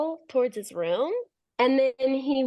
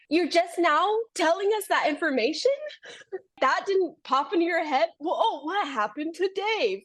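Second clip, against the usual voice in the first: incredulous exasperation; 6 semitones higher than usual. Retold or imitated. Exasperated at brothers' late news.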